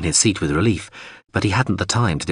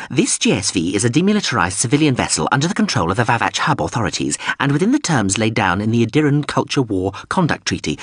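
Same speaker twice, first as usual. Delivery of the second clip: higher, huffy little robot voice; prim machine protesting, pitched up; indignant drone voice; 4 semitones higher than usual. Acted.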